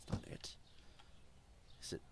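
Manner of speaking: breathy whispered dismissal. Real, not acted. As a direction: hush it to yourself. Thinking aloud, dismissing idea.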